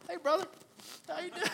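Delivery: high, put-on cheery hello; fake-friendly greeting. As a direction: switch to a sweet churchgoer's greeting. Instant switch to church greeting.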